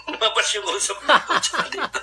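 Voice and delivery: high-pitched laughing retelling; can't stop laughing mid-line. Real, not acted.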